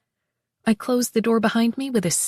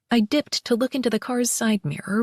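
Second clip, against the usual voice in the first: usual speaking voice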